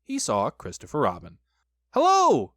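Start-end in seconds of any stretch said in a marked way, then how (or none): 1.9-2.5 high, loud happy hail; joyful shout. A narrator's reading. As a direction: shout a delighted greeting. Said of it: "he shouted joyfully".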